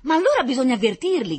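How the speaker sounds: loud, high-pitched cry; excited shout. A narrator's reading. Blond boy urging them to warn the others, shouting. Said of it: "gridò ancora il biondino".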